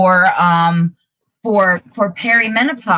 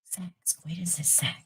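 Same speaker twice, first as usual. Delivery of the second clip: hushed, voiceless aside; whisper; 18 dB quieter than usual. Real, not acted. Explaining what coitus means to the co-host, whisper. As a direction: whisper it like a naughty secret. Explains the rude term under breath.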